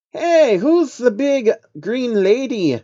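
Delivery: high, slow amazed creature voice; a wide-eyed alien spotting something new; gasping in wonder. Acted. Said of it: "and gasped".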